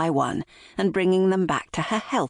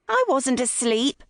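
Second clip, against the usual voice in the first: higher-pitched indignant denial; protesting; 8 semitones higher than usual. A narrator's reading. Mother caught napping answers her son, protesting. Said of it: "Holly protested".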